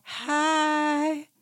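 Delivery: shy high hi, lightly voiced; mock Sutton. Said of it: "Sutton's like".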